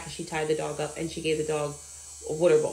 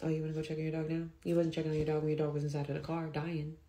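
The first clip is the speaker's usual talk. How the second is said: quick, hushed aside to oneself; inner thought; 3 semitones lower, 7 dB quieter, faster than usual. Retold or imitated. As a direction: think it under your breath, low-key and fast. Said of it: "And in my head".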